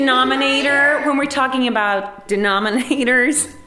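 teacher's exaggerated American classroom voice; American accent. Shown as a demonstration. Teacher demonstrates for the interviewer in an American accent. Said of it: "Will you do my American accent?".

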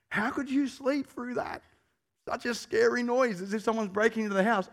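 husband marvelling that his wife slept through it; incredulous. Retold or imitated. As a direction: act out amazed disbelief at the wife.

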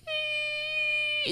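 very high thin steam squeal; balloon steam as a high squeal; squealing steam effect. Retold or imitated. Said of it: "steam would be like".

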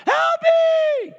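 high, slow, hammed-up cry for help; mock panicked cry. Husband mimicking his wife's panic, mock wail. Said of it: "drooling and pounding, going".

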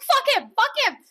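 loud angry outburst, laughing; angry yell. Retold or imitated. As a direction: shout it, then crack up. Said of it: "you're like".

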